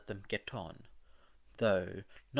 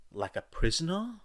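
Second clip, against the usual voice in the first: surprised; 8 semitones higher, 6 dB louder than usual. Acted. Midoriya shocked at son escaping.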